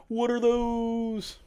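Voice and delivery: slow, high, stretched mock question; drawn-out meme quote. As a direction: stretch every word like the meme. Echoes the meme question slowly.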